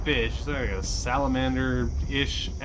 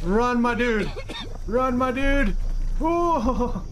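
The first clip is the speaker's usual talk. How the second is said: yelling; 9 semitones higher, slower than usual. Real, not acted. Yelling at character to flee.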